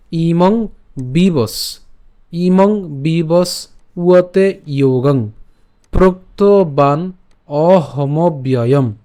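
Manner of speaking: ইমং বিবস উয়তে যোগং very slow, split at বিবস; slow broken demo. Shown as a demonstration. Teacher breaks the verse slowly to show where to split. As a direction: stretch each syllable slowly with a break after বিবস. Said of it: "উচ্চারণটা কেমন হবে?".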